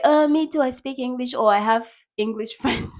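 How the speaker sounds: high-pitched, eager mimicry; mock showing off. Learner boasting just to say they speak English, high mocking eagerness. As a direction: put on an over-keen me-too voice.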